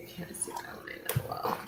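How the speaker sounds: a whisper while focusing on the cut; whispering so as not to break concentration. Real, not acted. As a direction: breathe the words out, no voice.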